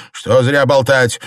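loud, high, slow dismissal; irritated shout. A narrator's reading. Husband silences his wife, shouting irritably. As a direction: shout her down. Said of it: "крикнул Дзялянь".

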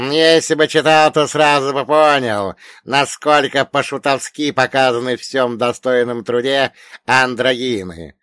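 loud, high, lecturing elder; didactic old man. A narrator's reading. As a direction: instruct your pupil pointedly. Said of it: "наставительно произнёс старик".

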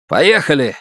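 a mild barked order; harsh barking commands. Acted. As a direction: snap out the order. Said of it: "рявкнул он".

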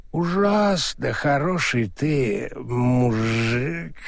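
put-on slow, maudlin drunken flattery; drunk, gushing with affection; drunk sentimental. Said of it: "пьяной сентиментальности".

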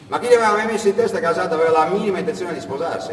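plain reading voice

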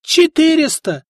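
frantic cry; shouted. A narrator's reading. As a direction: shout after him as he leaves. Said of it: "выкрикнул ему в спину ростовщик".

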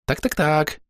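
high little chirped voice; excited insect chirp; chirping cricket. A narrator's reading. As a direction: chatter it like a cricket. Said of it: "застрекотал сверчок".

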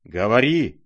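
loud, commanding cry; frenzied roar. A narrator's reading. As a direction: roar the order in a fury. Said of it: "ревел генерал в совершенном исступлении".

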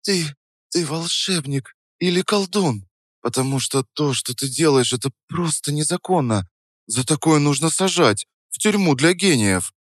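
high-pitched, blissed-out female character voice; awestruck Саша. A narrator's reading. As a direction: marvel at the cook, pitched well up. (восхищённый высокий женский голос)

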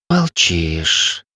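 breathy, languid low voice; languid sigh. A narrator's reading. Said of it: "томно вздохнула Ивил".

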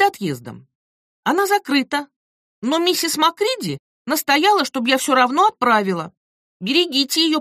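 put-on prim older woman's note; letter writer voice.

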